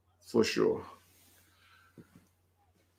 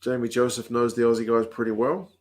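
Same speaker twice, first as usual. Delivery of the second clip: ordinary delivery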